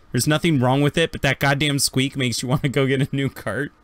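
usual speaking voice

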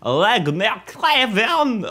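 put-on a silly guess at an Estonian accent, said very slowly and high; mock Estonian accent. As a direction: ham up a made-up Estonian accent, dragging the name out. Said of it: "So here's my impression".

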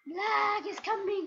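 high, stretched-out eerie call; slow, high mock-horror announcement; mock spooky warning. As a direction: draw it out like a playful ghost warning. Mock spooky warning about game lag.

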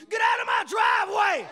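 high, loud cranky shout; old man yelling. Retold or imitated. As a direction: bark it like a grumpy old neighbor. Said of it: "the only thing out of your mouth is".

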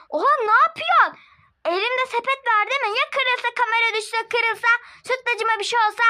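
high, loud outraged complaint; indignant protest. Acted. Girl scolds the rough shopkeeper, high and loud. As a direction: protest being pushed around.

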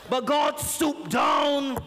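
slow, drawn-out pulpit crescendo; full-force preaching. Real, not acted. Preacher telling congregation God shaped man, full-force preaching. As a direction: preach it out, stretching each big word.

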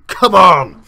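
loud, high bellow; rousing shout. Real, not acted. Player rallying his team before kickoff, rousing shout. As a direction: yell to fire up the team.

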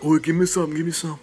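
high, whiny begging voice; hammed-up pleading for chips; mock begging kids.

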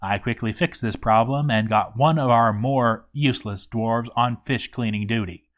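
usual speaking voice

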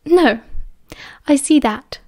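plain reading voice

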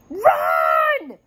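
high, drawn-out shout; yelled. A narrator's reading. Drake warning the others of collapse, urgent yell. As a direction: shout it long and high in alarm. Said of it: "Drake yelled".

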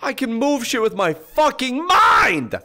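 high, loud, slow amazed yell; excited shout. Real, not acted. Gains new power in game.

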